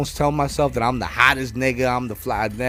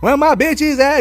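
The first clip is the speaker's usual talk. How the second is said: high, loud camp call; drag host; 13 semitones higher, 6 dB louder than usual. Retold or imitated. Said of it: "she doing that shit".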